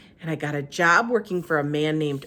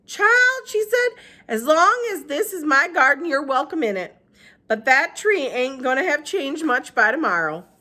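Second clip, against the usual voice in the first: Gloria Dump voice; 9 semitones higher, 6 dB louder than usual. Acted. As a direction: speak up high and unhurried.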